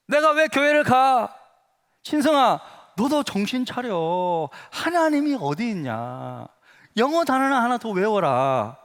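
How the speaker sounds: mock scornful brush-off, words drawn out; dismissive retort. Classmate rebuffing a church invitation, dismissive and drawn out. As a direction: act out a friend scoffing, stretching the endings.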